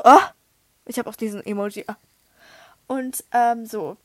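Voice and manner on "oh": short, rising mock gasp; quick startled "oh"; shocked gasp. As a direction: act out a sudden gasp of shock. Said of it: "das hat mich erstmal richtig schockiert".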